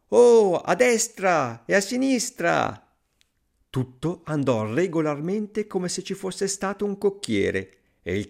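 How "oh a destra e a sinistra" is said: high, loud, drawn-out horse commands; shouted commands. A narrator's reading. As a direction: call directions slowly to a horse. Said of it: "gli gridava". (comandi gridati, acuti e lenti)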